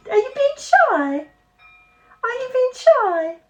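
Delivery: very high, sing-song coaxing. Real, not acted. Owner coaxing her shy dog to come up, high cooing voice.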